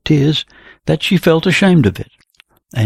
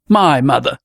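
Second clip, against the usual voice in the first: usual speaking voice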